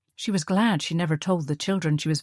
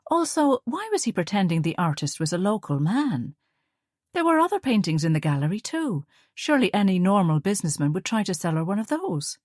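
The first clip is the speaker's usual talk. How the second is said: ordinary delivery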